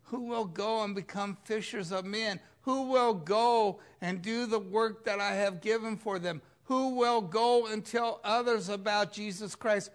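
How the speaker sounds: God calling. Pretend. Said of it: "he asks".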